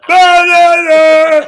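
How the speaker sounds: high, very loud, slow nonsense singing; mock sung outburst. Bunny drowning out the stagehand's pleas, loud slow nonsense singing. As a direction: belt the syllables to drown her out.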